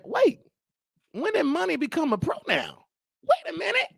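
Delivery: high-pitched, puzzled reenactment; baffled double-take. Retold or imitated. Host puzzled that money became a pronoun, acted bafflement. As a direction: pitch jumps up in mock confusion. Acting out confusion about pronoun answer.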